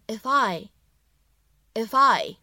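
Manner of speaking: "if I" linked smoothly, the f running into I. Shown as a demonstration. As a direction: say "if I" slowly as one word, "i-fI". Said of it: "我们可以连读一下".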